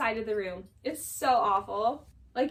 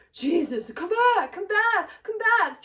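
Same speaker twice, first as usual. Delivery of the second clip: high, louder mock pleading call; imitated calling out; 6 semitones higher, 5 dB louder, slower than usual. Schwartz as Katie walks off, mock calling after her. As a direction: call after them in exaggerated repeats, pitched up.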